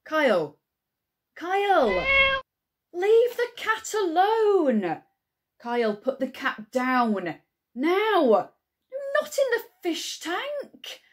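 high, loud, drawn-out telling-off; exasperated parental shout. Acted. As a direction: yell across the house at a misbehaving child. Calls out to Kyle off mic.

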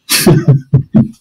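short burst of real laughter, breathy and loud; loud self-deprecating laugh. Real, not acted. Host laughing after calling himself second best, loud laugh. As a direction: laugh at your own joke, out loud.

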